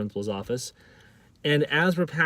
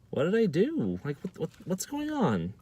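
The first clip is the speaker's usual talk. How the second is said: higher, softer bewildered protest; 6 semitones higher, 4 dB quieter than usual. Retold or imitated. Accused student asking the angry crowd, bewildered. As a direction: act out being caught totally off guard. Acting out own bewildered reply.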